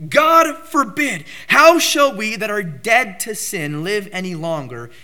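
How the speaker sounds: loud, forceful scripture quote; strongest emphasis. Acted. Preacher quoting Paul's emphatic answer from verse two, forcefully. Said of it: "this is the strongest point of emphasis".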